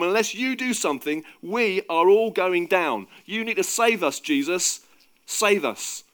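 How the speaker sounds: loud, high, urgent shouting; panicked, insistent appeal; desperate pleading. A narrator's reading. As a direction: beg for rescue over the storm. Peter pleads as boat is sinking.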